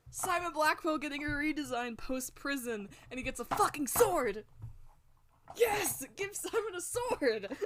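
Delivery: high-pitched, building to an ecstatic cheer; real fan excitement. Real, not acted. Streamer cheering for a character's new sword, excited. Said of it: "I just got really excited".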